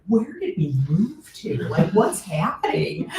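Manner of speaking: playful "what is going on" dismay; mock bewilderment. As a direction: retell it as baffled disbelief, close to laughing. Acted alarmed reaction to neighbours' warnings.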